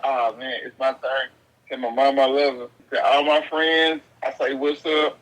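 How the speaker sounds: low, hammed-up dying farewell; imitated last words. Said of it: "He was like".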